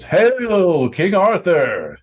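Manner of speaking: loud high chant, slow and stretched; crowd echoing. Acted. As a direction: echo the hail as a whole crowd. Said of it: "And the crowd echoes".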